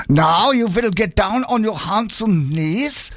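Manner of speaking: bossy hammed-up German; German accent. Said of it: "pantomime German accent".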